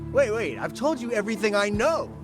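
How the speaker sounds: urgent protest under threat; pleading. Acted. Cornered informant to captor, urgent pleading.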